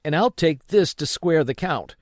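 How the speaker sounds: snatch it up with urgency; boy grabbing the packet in haste; urgent boy. A narrator's reading. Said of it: "said I".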